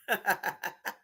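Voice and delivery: low, soft, rapid chuckle. Real, not acted. Woman laughing at her own point, low chuckle. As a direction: chuckle quietly to yourself.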